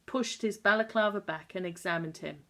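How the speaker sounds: ordinary delivery, nothing marked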